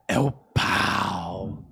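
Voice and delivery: "É o Paulo" mais grave e bem arrastado; voz de personagem, grave e lenta, pro sobrinho imitar. Shown as a demonstration. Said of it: "Faz igual teu tio". (tio repete a voz do Paulo, mais grave e bem lenta)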